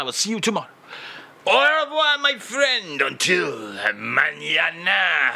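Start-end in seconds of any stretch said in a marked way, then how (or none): 1.5-3.0 high farewell call. Acted. Host calls goodbye. 3.0-5.3 slow, called-out mock Spanish goodbye. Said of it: "He also speaks fluent Spanish".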